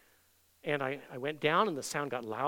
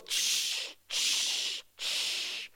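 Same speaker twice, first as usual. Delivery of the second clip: sharp voiceless "tsh" hiss; imitated sizzle; much slower than usual. Preacher imitates Jesus' burning feet at each step, hissing sizzle. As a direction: sizzle like hot coals touching down.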